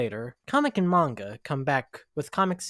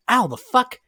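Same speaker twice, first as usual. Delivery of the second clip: much higher and louder, sudden outburst; pained startled yelp; 11 semitones higher, 10 dB louder than usual. Acted. Comic hurting his knee on a hidden tape, startled yelp. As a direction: yelp as the pain hits.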